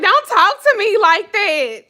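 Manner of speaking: high, loud indignant snap. Retold or imitated. Wife scolding husband, high loud indignant. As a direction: put him in his place.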